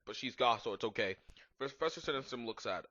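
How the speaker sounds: ordinary talk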